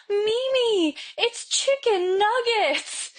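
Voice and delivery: put-on gasp, then high, drawn-out child's squeal of discovery; excited little kid. Small boy spotting chicks backstage to Mimi, excited high voice. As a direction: imitate an amazed small child, very high and slow. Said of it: "Tiffany's oldest is, again, five, six".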